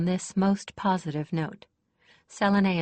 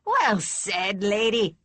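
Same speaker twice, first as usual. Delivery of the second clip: put-on louder, hearty old lady's approval; applauding; 3 semitones higher, 5 dB louder, slower than usual. A narrator's reading. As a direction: cheer her on heartily. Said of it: "Kester applauded".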